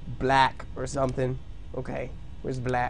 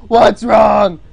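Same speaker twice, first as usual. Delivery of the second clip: sudden loud alarmed yell; shout; 4 semitones higher, 11 dB louder than usual. Real, not acted. Streamer yelling at his own doodle. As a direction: yelp it at the drawing. Excited reaction to drawing.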